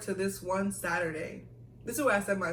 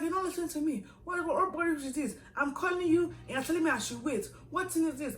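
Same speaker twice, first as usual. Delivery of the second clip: lightly voiced scolding senior; 9 semitones higher than usual.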